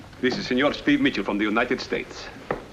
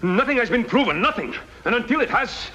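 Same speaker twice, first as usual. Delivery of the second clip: high indignant outburst; 9 semitones higher than usual. Acted. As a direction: pitch jumps up high, indignant and heated. Colonel protests the accusation.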